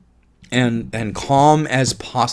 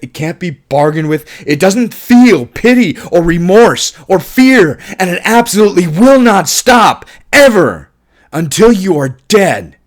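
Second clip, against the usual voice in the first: mounting Terminator warning, starting near plain and ending much louder and higher; intense quote building; 5 semitones higher, 6 dB louder than usual. Retold or imitated. Quoting Kyle's warning about the Terminator, building to loud urgency. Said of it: "his performance of that was stellar".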